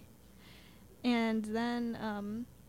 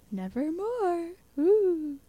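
slow, higher-pitched mock-spooky call; playful haunting croon, pitched up; spooky raven; 8 semitones higher, 5 dB louder, slower than usual. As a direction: draw it out like an eerie bird. Playfully voicing the raven of the poem.

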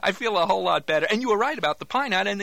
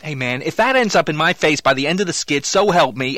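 loud threatening warning; 8 dB louder than usual. Acted. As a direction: warn him loudly, half-joking. Warning about pie in face.

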